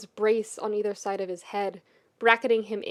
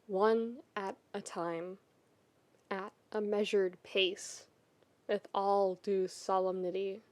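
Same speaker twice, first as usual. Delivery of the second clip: measured pace; 8 dB quieter than usual. A narrator's reading. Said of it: "like prisoners marching to the gallows".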